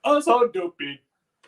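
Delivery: high, loud playful echo; loud mock echo. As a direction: bellow it back, hammed up.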